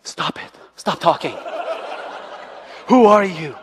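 wife telling off her husband, playfully exasperated; wife scolding. Retold or imitated. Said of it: "She's like".

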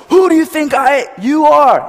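high, loud imitation of a bowler; hammed-up outburst; mock passionate shout. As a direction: yell it like an overwrought champion. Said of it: "he's so passionate".

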